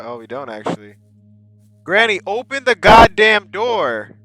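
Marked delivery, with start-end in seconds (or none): 1.8-4.2 loud, higher, demanding yell; exasperated shout. Pretend. Grandchild locked out yelling at grandma, exasperated shout. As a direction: holler at the locked door.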